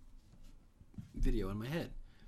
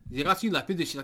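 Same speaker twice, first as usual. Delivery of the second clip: mock French; 7 semitones higher, 10 dB louder than usual. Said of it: "they're all like".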